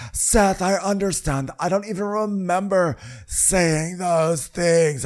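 high, drawn-out imitation plea; hammed-up high-pitched apology; mock pleading. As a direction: stretch it out in a high, put-on voice. Acted pleading reply to Seth.

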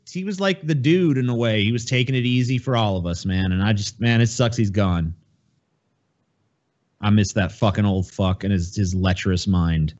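normal talking voice

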